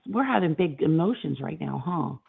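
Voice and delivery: ordinary delivery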